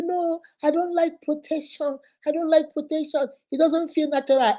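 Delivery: a high mocking man's excuse; mock whiny man. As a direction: whine it like a spoilt man. Boyfriend voiced.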